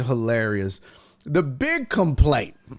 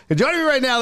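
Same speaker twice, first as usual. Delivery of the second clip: announcer intro; 4 semitones higher, much faster than usual. Shown as a demonstration. Host to viewers, introducing his mom with announcer hype. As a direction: present her like a show host. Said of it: "I'm gonna give her a proper introduction".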